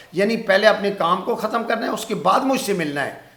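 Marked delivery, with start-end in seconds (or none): none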